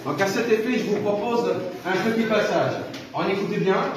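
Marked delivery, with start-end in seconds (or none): none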